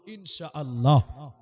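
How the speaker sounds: loud, slow rallying call; leading a response. Shown as a demonstration. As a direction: draw it out for the crowd to repeat. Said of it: "সবাই বলুন".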